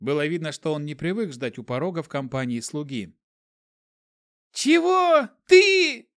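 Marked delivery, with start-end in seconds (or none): 4.5-6.1 high indignant dwarf squeal; pixie. A narrator's reading. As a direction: flare up, high and loud. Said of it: "вскинулся было карлик".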